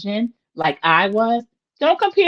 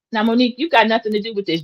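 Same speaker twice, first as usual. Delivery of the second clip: ordinary talk, nothing marked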